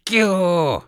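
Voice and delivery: high, loud squeal. Acted. U Ef squeals the insult hanging on Bi's beard. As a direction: shriek it as you grab the beard. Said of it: "завизжал У Эф".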